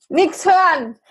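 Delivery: loud and high; to someone hard of hearing. Real, not acted. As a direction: call out over a bad line. Mic not working, calling out.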